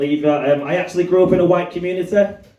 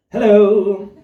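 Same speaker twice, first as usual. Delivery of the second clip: prim polite hello; 4 semitones higher, 4 dB louder than usual. Retold or imitated. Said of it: "And I was like".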